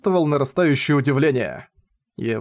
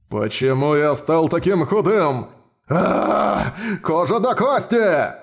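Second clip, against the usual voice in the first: sputtering foiled villain; 2 semitones higher, slower than usual. Acted. Minotaur sees himself shrunk.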